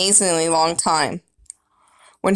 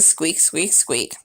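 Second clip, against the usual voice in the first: whispered squeak; 3 semitones lower, 6 dB quieter, slower than usual. A narrator's reading. Hamster can only squeak.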